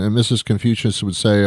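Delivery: ordinary talk, nothing marked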